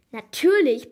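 a proud, loud brag; boastful. A narrator's reading. Angelina brags to Zoe, loud and proud. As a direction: show off with one loud word. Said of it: "prahlte Angelina".

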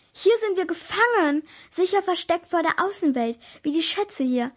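high, loud lament; hopeless wail. A narrator's reading. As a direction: wail it out as if all hope is lost. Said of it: "jammerte ich hoffnungslos".

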